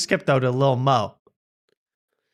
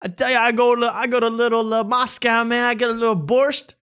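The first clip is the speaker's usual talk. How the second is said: high-pitched mock comic delivery; 8 semitones higher, 3 dB louder than usual. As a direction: parody the repetitive bit in a raised voice. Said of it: "everything's the same. It's like".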